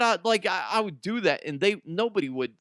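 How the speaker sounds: ordinary talk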